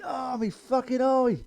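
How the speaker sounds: high, slow cry of pain; pained yelp. Retold or imitated. Mate poked through the fence hole, yelping in pain. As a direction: yelp like you've been poked in the eye. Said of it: "He went".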